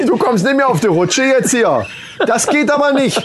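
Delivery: high, laughing mock nanny scolding. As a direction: scold a naughty kid while laughing. Plays Super Nanny scolding a child.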